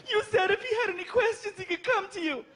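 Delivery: high, indignant mock girlfriend; a high shrill-voiced mock mom; angry girlfriend. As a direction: scold like a protective mother.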